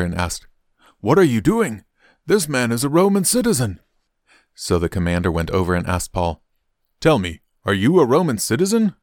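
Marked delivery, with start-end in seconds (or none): 1.0-3.8 high-pitched, urgent exclamation; alarmed protest. A narrator's reading. Officer warning the commander about a Roman citizen, alarmed. 7.0-8.9 higher-pitched, pressing question; urgent questioning. A narrator's reading. Commander questioning Paul about his citizenship, urgent.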